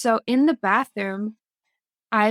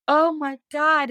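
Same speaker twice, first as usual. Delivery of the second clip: retold alarm; 6 semitones higher than usual. Retells alarm at CPS warning.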